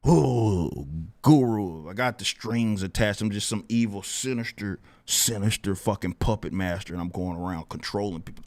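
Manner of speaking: low, hushed villain send-up; mock sinister mastermind. Streamer mocking claims he controls people, mock sinister mastermind. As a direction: intone it like a pretend evil puppet master.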